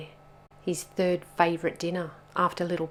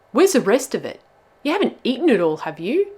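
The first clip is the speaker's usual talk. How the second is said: loud, higher, indignant questioning; accusing; 6 semitones higher, 11 dB louder, faster than usual. A narrator's reading. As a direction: demand answers from the culprit. Bubba accuses Rollo.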